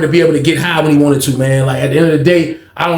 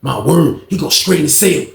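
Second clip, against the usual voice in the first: ordinary delivery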